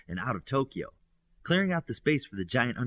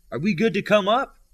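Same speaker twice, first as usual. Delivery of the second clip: called up from below, loud and high; calling up; 11 semitones higher, 10 dB louder than usual. A narrator's reading. Olympian calling up from the ground to Midas. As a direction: shout the question up to the balcony. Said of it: "Olympian said from the ground".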